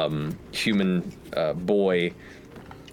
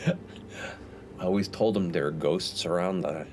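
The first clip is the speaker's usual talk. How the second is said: Sandus voice; 5 dB quieter, slower than usual. Acted. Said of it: "Sandus goes".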